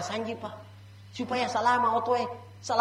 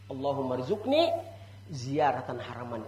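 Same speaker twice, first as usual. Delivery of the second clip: recited prayer; 9 semitones lower, 5 dB quieter than usual. Shown as a demonstration. (melantunkan doa pelan dan rendah)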